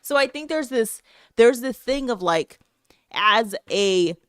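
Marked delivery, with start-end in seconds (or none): none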